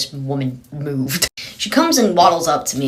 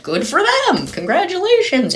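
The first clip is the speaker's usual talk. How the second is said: much higher, bright and upbeat; cheery congratulations. Retold or imitated. Speaker cheerfully congratulates the appalled woman, high and excited. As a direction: gush it out like happy news.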